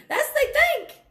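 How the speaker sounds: high, loud laugh through the words; amused, scornful laughter; laughing scoff. Real, not acted. Scoffing at what others assume.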